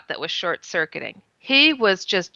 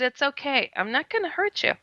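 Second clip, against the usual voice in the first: softer and higher, gentle; reassuring; 6 semitones higher, 5 dB quieter than usual. Retold or imitated. Woman calming a terrified little alien, soft reassuring voice. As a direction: coax a frightened child, softly. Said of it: "to calm him down".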